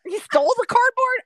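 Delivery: he stole what, really; incredulous. Real, not acted. Asking the cohost in disbelief about a fired coworker, incredulous. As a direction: pitch shoots way up in disbelief. Baffled follow-up question.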